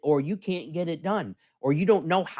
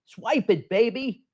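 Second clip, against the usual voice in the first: hyped up; 8 semitones higher, slower than usual. Real, not acted. Host hypes his own brand.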